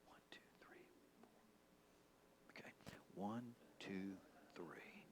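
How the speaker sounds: whispered count of points under breath. Retold or imitated. Hunter in his stand counting antlers in a whisper. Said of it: "I'm like".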